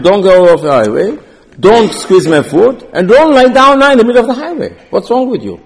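donkey's scolding that builds to a high, loud cry; exasperated donkey building to a yell; angry scolding. Acted. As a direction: scold him, rising to a shout by the end.

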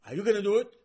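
loud, high, bold demand; demanding challenge. Retold or imitated. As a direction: confront God head-on with chutzpah. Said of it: "Moshe would never dare speak to Hashem like this".

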